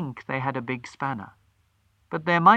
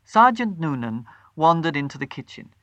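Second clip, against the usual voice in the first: ordinary delivery, nothing marked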